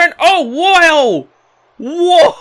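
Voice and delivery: loud, high astonished cry; excited whoa. Real, not acted. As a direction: shout in amazement at the crash. Big move crushes opponent.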